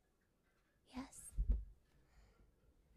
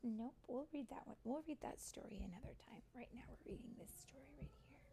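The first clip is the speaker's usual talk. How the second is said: ordinary delivery, nothing marked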